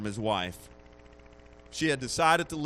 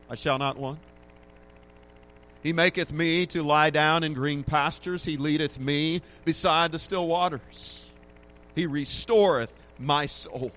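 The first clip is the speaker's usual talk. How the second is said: rising, louder preaching of the psalm; scripture reading; 3 semitones higher, 5 dB louder than usual. Acted. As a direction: proclaim the psalm with growing force. Reads Psalm twenty-three aloud.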